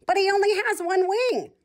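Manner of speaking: high, squeaky little duck. A narrator's reading. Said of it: "One of the ducks said".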